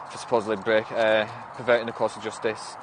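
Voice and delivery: ordinary delivery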